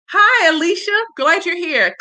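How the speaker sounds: high-pitched, happy hello; excited greeting. Real, not acted. Host welcomes a guest joining the live, excited. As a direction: welcome them with a high, eager lift.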